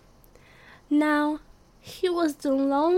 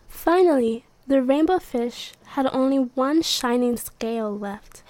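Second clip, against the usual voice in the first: plain reading voice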